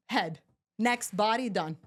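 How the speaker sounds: usual speaking voice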